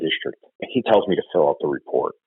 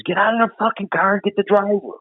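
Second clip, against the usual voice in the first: retold yell. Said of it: "he says".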